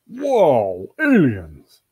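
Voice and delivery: drawn-out, swooping wonder; amazed. Acted. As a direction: awestruck character exclamation. Dog amazed at meeting aliens.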